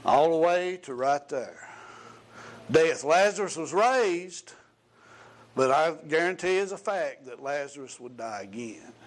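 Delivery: loud, emphatic sermon delivery; preaching. Acted. Preacher calls out to the congregation about Jesus's death. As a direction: proclaim death and the road to Emmaus.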